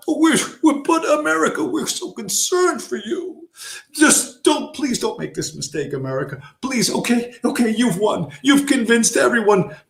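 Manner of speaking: high, pleading mock whine; mock concerned banker. Mocking Wall Street pleading with America to sell. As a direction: beg like a fake-caring banker. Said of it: "They're concerned".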